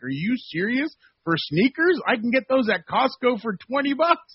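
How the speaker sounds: mock outrage over pricey sneakers; incredulous older reporter. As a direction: scoff at the price like an old reporter. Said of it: "He's like".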